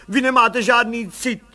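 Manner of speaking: high, loud outburst; young mother bursting out at the commissioner. A narrator's reading. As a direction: snap at him in hurt anger. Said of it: "vybuchla mladá paní".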